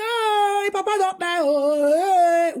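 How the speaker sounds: high, taunting fake lament; mock her mourning, singsong and high; mocking wail. Ex-husband's tailor mocking her grief, high mock wail. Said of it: "begin saga me, bully me".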